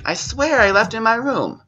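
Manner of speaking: put-on high-pitched child protesting; whiny kid. Child protesting to mom, high whiny voice. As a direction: insist like a kid who is sure. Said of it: "They'll be like".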